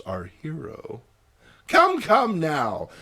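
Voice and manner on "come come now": put-on slow, loud tutting old aunt. Aunt May chiding the ladies, tutting slowly. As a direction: tut at them gently. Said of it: "tuts Aunt May".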